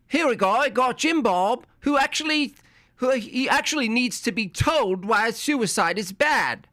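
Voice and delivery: high, mocking impression of the caller; high mocking voice of a clueless caller. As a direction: sneer it out in a high put-on voice.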